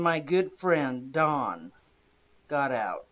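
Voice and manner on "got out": usual speaking voice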